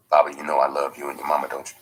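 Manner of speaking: lightly voiced stepfather, fast and a little low; low rapid pitiful words. A narrator's reading. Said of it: "his low, rapid flow of pitiful words".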